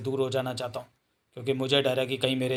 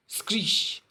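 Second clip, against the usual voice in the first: high hissing screech effect; imitated screech; 8 semitones higher, slower than usual. A narrator's reading. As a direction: voice a sudden magical screech. Comic sound effect read aloud.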